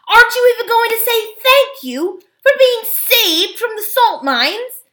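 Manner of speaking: very high, loud outraged protest; affronted schoolboy at full pitch; indignant boy. A narrator's reading. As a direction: scold them in shrill disbelief. Boy scolds ungrateful dwarfs.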